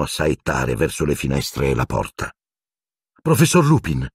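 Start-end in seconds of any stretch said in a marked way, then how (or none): 3.2-4.0 timid. A narrator's reading. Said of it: "intervenne Hermione timidamente".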